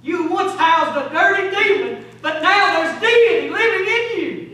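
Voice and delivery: loud, high-pitched exhortation; full-force preaching. Real, not acted. Preacher urging sinners to come before God, full-force preaching. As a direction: drive it home to the whole church.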